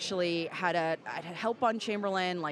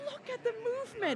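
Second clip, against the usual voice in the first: much higher, squealing with delight; gushing exclamation. Retold or imitated. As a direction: gush over the flowing costume like a proud fan. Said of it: "and I'm like".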